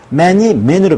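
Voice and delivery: man then men, each vowel drawn out slowly; slow vowel demo. Shown as a demonstration. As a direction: spell out 'man이 man으로' slowly, a to e. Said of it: "먼저 모음이 변하는 것입니다". ('man이 man으로' 모음을 천천히 또박또박)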